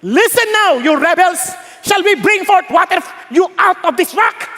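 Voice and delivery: high, loud rebuke to the crowd; acted furious shouting; angry shout. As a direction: lash out at them like furious Moses. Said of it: "tignan niyo, sa galit niya".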